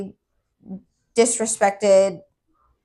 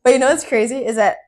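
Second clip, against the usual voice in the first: ordinary talk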